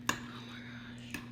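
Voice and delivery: breathy whispered awe; whispering. Real, not acted. Whispered reaction to food.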